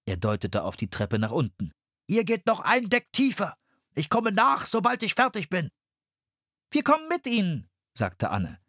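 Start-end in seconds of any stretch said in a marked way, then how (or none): 2.1-5.7 higher adult voice, pressing; urgent Henry. A narrator's reading. Henry sends the children below deck, urgent. 6.7-7.7 put-on much higher girl's voice; eager girl. A narrator's reading. Anne insists on going with Henry, eager.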